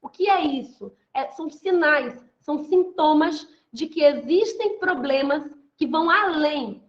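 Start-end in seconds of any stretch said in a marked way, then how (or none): none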